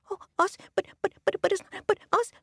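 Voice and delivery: very high stammering squeak; startled frogs. Acted. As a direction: stutter in tiny shock. Frogs shocked.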